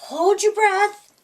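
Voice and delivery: encouraging. Acted. Mom instructs Pete.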